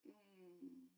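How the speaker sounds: soft, deadpan sarcastic thought; dry inner voice. Retold or imitated. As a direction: murmur it like a private eye-roll. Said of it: "in my mind, I'm like".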